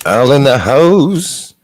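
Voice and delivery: slow, loud hype shout-out; announcer call. Acted. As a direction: call out the arrival like a ring announcer. Announcing a viewer.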